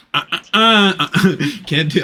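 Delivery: high, loud stammering scold; mock scolding. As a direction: stammer it out like wagging a finger. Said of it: "see this".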